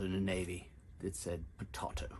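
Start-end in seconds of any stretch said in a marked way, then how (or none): 1.6-2.1 "potato" as puh-TAH-toh; broad TAH in the middle of "potato"; English-style pronunciation. Shown as a demonstration. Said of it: "watching maybe an English cooking show".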